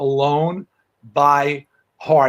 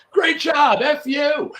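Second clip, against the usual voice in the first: high-pitched, hearty congratulatory call; re-enacted jubilant holler; mock cheering shout; 10 semitones higher, slower than usual. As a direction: call it out like backstage praise. Performers cheering each other offstage, retold.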